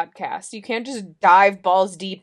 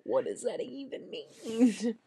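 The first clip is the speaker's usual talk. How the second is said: laughing; 9 dB quieter, much slower than usual. Real, not acted. Asks while laughing.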